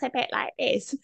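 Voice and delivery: put-on high-pitched comic old lady voice; squeaky cartoon granny; little old lady. Shown as a demonstration. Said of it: "She's a little old lady".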